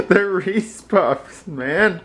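slow, high, laughing correction. Real, not acted. Correcting her while laughing.

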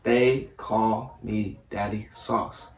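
very slow, soft and low, word by word. Real, not acted. Player gives friend another try at guessing, very slowly. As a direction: leave a gap after each word. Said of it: "Say it again, slower".

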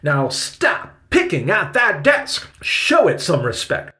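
high, loud, emphatic scolding; stern attack. A narrator's reading. Victoria scolds Benny for picking the desk, stern attack. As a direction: scold the boy with punched words. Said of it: "continued her attack".

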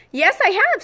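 put-on high eager girl voice; little girl voice. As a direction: pipe up brightly like a young girl. Lucy answers eagerly.

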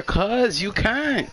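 high and very slow, drawn out; mocking dismissal. Friend retelling the seat refusal, drawn-out high taunt. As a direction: stretch it out high like a brush-off. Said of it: "He said".

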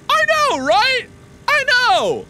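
very high, loud gleeful agreement; excited. Acted. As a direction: squeal it in delight.

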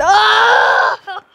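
loud drawn-out yell; scream. Real, not acted. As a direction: cry out loudly, holding the sound. Startled scream in the game.

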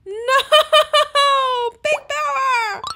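high, laughing mock cry; mock wail. Gamer laments Pig Power's wig, hammed-up wail. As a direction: wail the name through giggles, drawn out.

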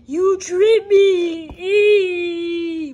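very slow high crying voice; high wailing complaint from the hurt pet; crying accusation. Acted. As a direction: drag it out, sobbing.

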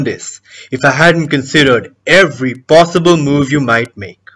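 deliberate, taunting stage monologue; cocky villain explaining the plan; gloating schemer. Acted. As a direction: lay out the trap with relish, pausing between moves. Performs the schemer's monologue from a play.

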